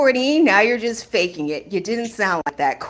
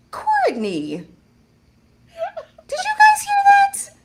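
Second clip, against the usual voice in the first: high-pitched playful scolding; pearl-clutching shock, pitched way up; mock scandalized. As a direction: squeal in mock outrage.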